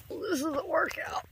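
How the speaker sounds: this is a workout, said up high; high-pitched repeat. Real, not acted. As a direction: pitch it up in mock complaint.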